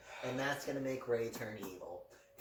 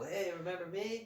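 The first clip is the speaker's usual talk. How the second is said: mock Qui-Gon; 8 semitones higher than usual. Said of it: "Qui-Gon will come in and go".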